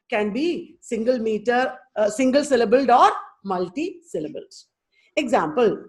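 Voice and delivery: ordinary talk, nothing marked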